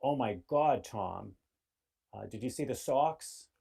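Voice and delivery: lively Boston local chatting; Boston accent. Retold or imitated. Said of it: "where I live in Boston".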